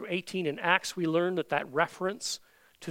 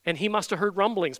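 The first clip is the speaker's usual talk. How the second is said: usual speaking voice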